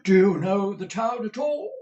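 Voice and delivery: high, loud theatrical manager voice; booming stagey manager asking; Mr Crummles the manager. A narrator's reading. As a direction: play the bluff old actor-manager.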